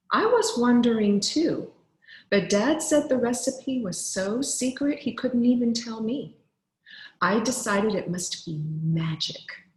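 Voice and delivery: normal talking voice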